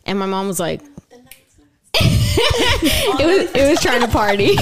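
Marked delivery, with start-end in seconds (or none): 1.9-4.6 words squeezed out through loud laughter; cracking up over the partying Ouija board; laughing hard. Real, not acted. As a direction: crack up while telling it.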